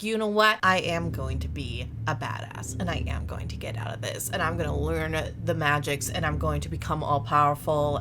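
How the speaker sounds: lightly voiced captive girl, low and soft; low, quiet vow of revenge; captured girl's vow. Imprisoned girl vowing revenge on her captors, low resolve. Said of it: "and she's like".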